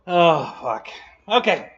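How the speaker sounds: ordinary delivery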